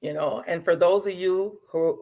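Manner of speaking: ordinary delivery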